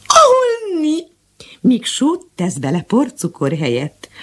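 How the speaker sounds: high, slow jeering pets; mocking animal voices. The pets mock Mariska's coffee, high and jeering. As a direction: sneer at the burnt coffee.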